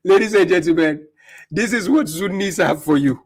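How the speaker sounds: mock announcer. Real, not acted. Host announcing to his audience.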